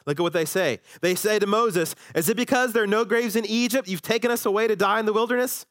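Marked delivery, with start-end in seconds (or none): 2.1-5.6 fast, higher mock-sarcastic complaint; a touch of sneering sarcasm. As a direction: whine it like a sarcastic Israelite. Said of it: "That's totally sarcastic".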